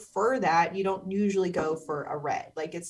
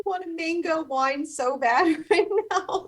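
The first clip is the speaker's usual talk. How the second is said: high, laughing craving; 9 semitones higher, 4 dB louder than usual. Real, not acted. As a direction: laugh through the line. Craving mango wine, laughing.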